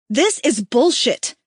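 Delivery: loud, slow furious bellow. A narrator's reading. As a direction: bellow it out in outrage. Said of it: "she bellows".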